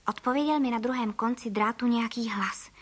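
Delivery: ordinary delivery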